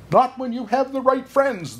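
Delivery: high, reassuring bird; little bird voice. Acted. Bird reassuring Anja, high little voice. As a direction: answer like a kind tiny bird. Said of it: "the bird answered".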